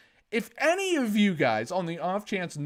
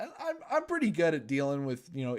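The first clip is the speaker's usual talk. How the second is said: ordinary talk, nothing marked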